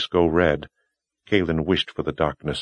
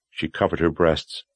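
ordinary delivery, nothing marked

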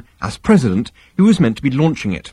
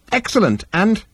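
much higher, bright therapist voice; smooth psychiatrist; 11 semitones higher than usual. Acted. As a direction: chirp it like a smug shrink. Halfront voice.

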